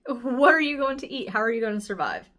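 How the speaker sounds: ordinary delivery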